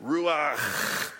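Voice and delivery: "Ruach" with a harsh throaty ch; Hebrew word. Shown as a demonstration. Praising the class's "Ruach" and repeating it. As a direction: lean on the final ch of "Ruach". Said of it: "you guys did the cha at the end".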